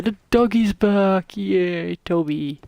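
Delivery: slow, delighted greeting to the dog; thrilled the dog is back; excited. Real, not acted. Dog comes home.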